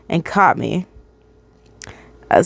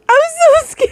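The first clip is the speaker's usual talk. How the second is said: squeaky high laughing whine; laughing mock fear. Reader joking about her fear, laughing high whine. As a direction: laugh through a put-on scared voice. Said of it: "repeats in mock voices".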